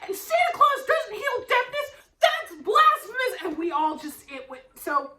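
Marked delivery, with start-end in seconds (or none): 0.1-3.3 high, loud, hammed-up indignant mom; a kid mimicking mom's indignant outrage; outraged mom impression. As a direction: imitate mom insisting it's blasphemous. Mom voiced insisting, outraged.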